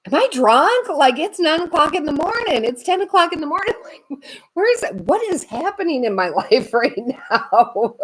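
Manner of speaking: high, bewildered, laughing through it; puzzled morning-after confusion; bewildered disbelief. Retold or imitated. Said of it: "I remember being like".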